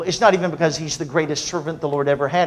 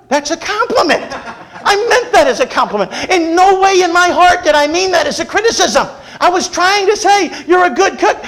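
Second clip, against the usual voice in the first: louder and higher, hammed-up pleading husband; mock exasperated protest; 14 semitones higher, 8 dB louder than usual. Husband insisting he meant a compliment, hammed-up wailing protest. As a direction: protest your innocence in a wailing, comic rising voice.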